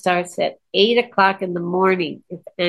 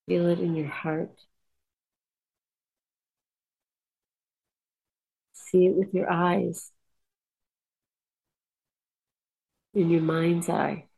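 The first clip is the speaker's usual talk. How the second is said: soft, unhurried meditation voice; guided meditation; 3 dB quieter than usual. Acted. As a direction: lead the listeners calmly inward.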